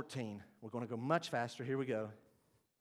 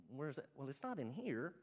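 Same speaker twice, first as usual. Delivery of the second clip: ordinary talk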